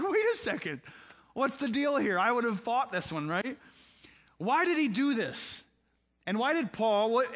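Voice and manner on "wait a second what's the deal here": high, startled protest; pitched-up startled objection; mock surprise. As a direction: play Timothy thrown, wait what. Timothy voiced protesting Paul.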